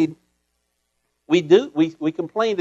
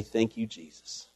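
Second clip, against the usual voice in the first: softer, lower, humble gratitude; humble thanks; 8 semitones lower, 10 dB quieter than usual. Shown as a demonstration. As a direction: say it quietly, head bowed. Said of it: "go before the Lord humbly".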